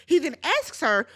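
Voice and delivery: ordinary talk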